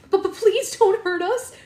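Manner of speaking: high, loud, stammering plea; acted terrified girl; frightened pleading. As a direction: beg in fear, voice jumping up. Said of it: "she was shaking with fear".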